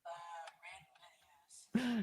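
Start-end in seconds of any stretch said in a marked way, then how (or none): 1.8-2.0 tinny, as if through a can; talking into a tin can; tin can phone. Real, not acted. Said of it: "he sounds like he's talking into a can".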